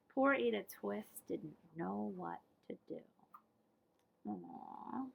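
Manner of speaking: soft, low pitying voice; sad sympathy. A narrator's reading. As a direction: feel sorry for her.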